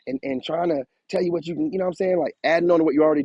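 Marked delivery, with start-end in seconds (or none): none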